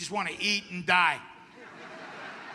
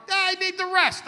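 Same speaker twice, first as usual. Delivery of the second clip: flippant shrug; 9 semitones higher, 4 dB louder than usual. Retold or imitated. Shrugging off stroke risk.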